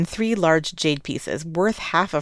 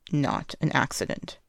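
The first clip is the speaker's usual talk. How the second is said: softly, tipsy and petulant; a lightly voiced soft-spoken drunk; soft drunk man; 3 semitones lower, 7 dB quieter than usual. A narrator's reading. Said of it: "the man's voice is deep and soft".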